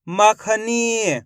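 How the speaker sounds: "मखनी" very slowly as one word; slow teaching read of "मखनी"; blended, drawn-out word. Shown as a demonstration. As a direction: hold the final ee of "मखनी". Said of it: "आपस में मिला के पढ़ेंगे".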